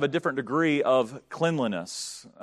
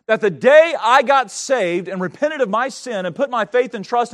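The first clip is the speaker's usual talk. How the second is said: loud, driving sermon delivery; fiery preaching; 7 semitones higher, 8 dB louder than usual. Acted. Pastor preaching to his church, loud and fervent. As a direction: preach it hard to the congregation.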